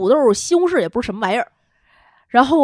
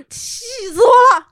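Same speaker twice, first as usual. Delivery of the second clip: higher, louder mock whine; mock fury; 13 semitones higher, 8 dB louder than usual. Wife playfully scolding husband on mic, mock fury.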